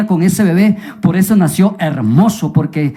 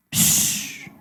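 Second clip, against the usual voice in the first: long, soft "shh"; unvoiced hushing sound, acted; shushing; 10 dB quieter than usual. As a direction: hush everyone with a drawn-out hiss. Hushing as inspector passes.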